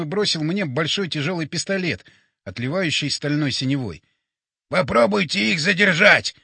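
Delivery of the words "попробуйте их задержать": urgent raised-voice order; shouted. A narrator's reading. Батя orders them to hold off the creatures, urgent shout. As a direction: call it out over the danger. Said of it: "крикнул он".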